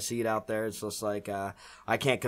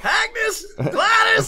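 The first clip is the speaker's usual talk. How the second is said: mock old lady shriek; 9 dB louder, much slower than usual. Zombie mock screaming names.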